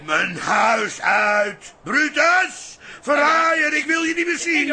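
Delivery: furious shout. Acted. Father bellowing at his scheming son, furious shout. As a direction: roar him out of the house, Brutus.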